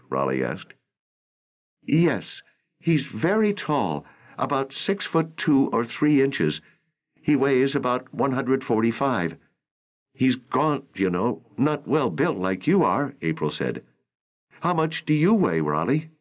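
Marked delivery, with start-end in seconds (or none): 1.8-13.1 raised, lightly voiced young actress; a worried young actress pleading, narrator lifts his pitch higher; April's voice. A narrator's reading. Narrator voicing April to Raleigh, worried and higher. 14.6-16.1 raised, lightly voiced young actress; a worried young actress pleading, narrator lifts his pitch higher; April's voice. A narrator's reading. Narrator voicing April to Raleigh, worried and higher.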